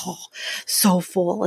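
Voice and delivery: slow, lightly voiced stuffed sigh; stuffed groan. Guests on Jersey Shore after pasta, slow stuffed sigh. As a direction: sigh it out like you ate too much.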